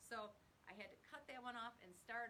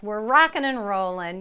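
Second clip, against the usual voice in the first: ordinary talk, nothing marked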